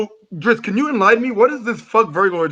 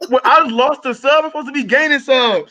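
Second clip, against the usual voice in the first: indignant disbelief; 7 semitones higher than usual. Real, not acted. Outraged at losing a subscriber.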